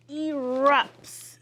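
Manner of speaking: "erupts" said slowly with a big loud RUPTS; long first sound in "erupts", then an explosive burst; drawn-out erupts. Shown as a demonstration. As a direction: stretch "erupts", punching the second syllable loud. Modelling new word for class.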